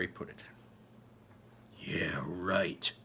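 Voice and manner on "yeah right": unvoiced sarcastic whisper under the breath; muttered. A narrator's reading. Narrator muttering doubt at the assembly, whispered. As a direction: mutter it so nobody else hears. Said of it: "I mutter".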